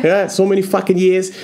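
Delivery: high Boston guy voice; Boston accent. Retold or imitated. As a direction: keep the Peter Griffin-style Boston voice. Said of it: "Not a Boston accent".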